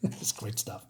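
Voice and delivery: laughing aside. Real, not acted. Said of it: "laughing aside".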